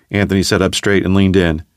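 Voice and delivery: ordinary delivery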